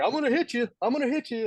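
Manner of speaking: high, frantic mock threat; retold panicked threat, pitched high. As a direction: squeak it out like he's cornered by a snake. Scared coworker threatening.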